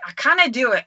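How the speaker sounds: put-on Scottish, giving up; attempted Scottish accent. Shown as a demonstration. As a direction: protest in a shaky attempt at Scottish. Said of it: "Say it in a Scottish accent".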